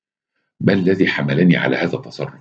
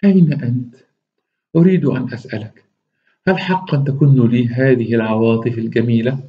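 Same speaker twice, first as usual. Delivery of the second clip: higher coy woman's voice; Zaza's voice; 4 semitones higher than usual. Acted. Zaza on the phone asks Rashid about his feelings, coy higher voice. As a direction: ask him coyly, fishing for love. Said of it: "قال".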